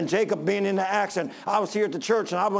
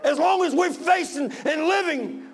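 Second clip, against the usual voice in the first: high, loud shouted sermon; impassioned pulpit shout; full-force preaching; 8 semitones higher, 3 dB louder than usual. Real, not acted. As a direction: cry it out to the congregation in long ringing phrases.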